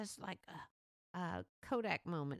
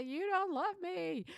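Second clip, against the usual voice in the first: put-on higher, louder, slow sulky child; whiny child; 10 semitones higher, 6 dB louder, slower than usual. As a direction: whine like a tantruming kid. Child having a tantrum.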